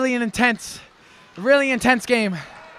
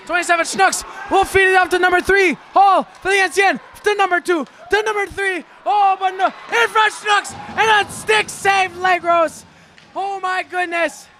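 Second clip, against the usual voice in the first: excited shout; 5 semitones higher than usual. Acted. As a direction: yell it as the puck bounces in front. Scramble in front of net.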